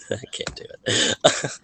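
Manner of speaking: breathy, through giggles; laughing too hard to go on. Real, not acted. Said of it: "I'm laughing too much".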